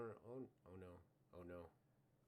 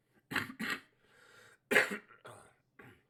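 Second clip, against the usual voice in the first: loud coughing fit; 4 semitones higher, 23 dB louder than usual. Real, not acted. Coughing fit mid draft.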